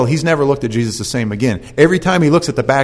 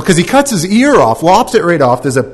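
usual speaking voice

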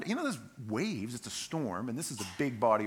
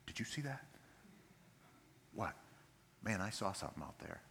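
quiet, uneasy rower's voice; acted hushed exchange in the boat; hushed disbelief; 4 semitones lower, 13 dB quieter than usual. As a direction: say it low to your crewmate. Acts rowers spotting something in storm.

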